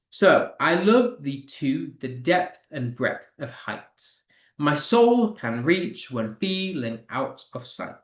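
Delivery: slow reading, punching every second syllable as in "LOVE", "TWO", "DEPTH"; stressed syllables. Shown as a demonstration. Teacher reading a sonnet to show the metre, stressing each beat. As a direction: lean hard on "love", "soul", "reach", lighter between. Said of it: "stressing the syllables so you can hear them".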